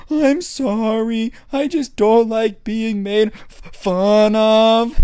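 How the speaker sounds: high, slow teary whine; crying kid. Retold or imitated. Crying kid apologizing to the coach, high teary whine. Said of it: "And the kid's like".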